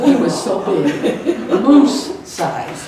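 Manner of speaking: laughing. Real, not acted. Describing the huge hockey player.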